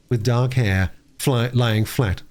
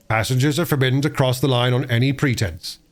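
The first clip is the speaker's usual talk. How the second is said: ordinary delivery, nothing marked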